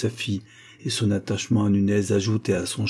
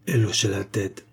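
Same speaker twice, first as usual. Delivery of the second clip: ordinary delivery, nothing marked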